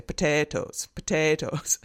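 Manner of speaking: put-on "potatoes" in a joking Irish accent. Lecturer quoting Francis's joke from the recording, Irish accent. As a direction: imitate an Irish speaker saying "potatoes". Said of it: "you want the Irish accent, you just say".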